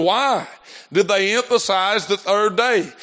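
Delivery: ordinary delivery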